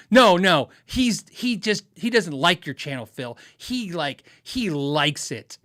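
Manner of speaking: imitating a wife, loud "No, no", then drawn-out "likes"; emphatic tattling wife. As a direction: stretch and punch "likes" in mock insistence. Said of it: "Or they'll say".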